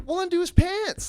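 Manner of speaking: high, loud mock exasperation; hammed-up impatient instruction; impatient order. Acts out impatient order to her.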